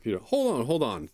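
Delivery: lightly put-on accent for Dora; a touch of an unplanned character accent. Co-host role-playing Dora to Peter, put-on accent. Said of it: "Oh, am I doing an accent?".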